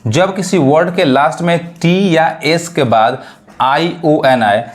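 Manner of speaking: ordinary delivery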